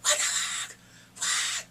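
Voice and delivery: loud re-enacted disbelief; shocked outburst. Viewer reacting to the torture room, loud shocked outburst. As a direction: exclaim loudly in shock.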